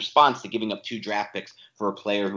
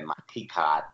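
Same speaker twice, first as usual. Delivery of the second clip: lower, offhand mock Boston accent; 7 semitones lower, 4 dB quieter than usual. Host echoing his partner's joke, light Boston accent. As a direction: echo the Boston "key card" with dropped R. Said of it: "Yeah, there you go".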